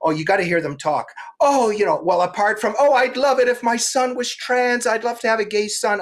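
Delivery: high, gushing mock voice; a hint of gushing ladies; mocking gym women. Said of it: "and they're like".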